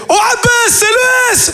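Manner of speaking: high shouted mock greeting; kid brother greeting dad. Little brother calling dad by name, high shouted greeting. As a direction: squeal it, cheeky and overfamiliar. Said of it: "il fait".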